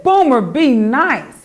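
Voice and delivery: loud, slow angry telling-off; angry scold. Acted. The boys angrily shout at Boomer for throwing the ball. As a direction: bark it out slowly at the bully. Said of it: "the boys were angry".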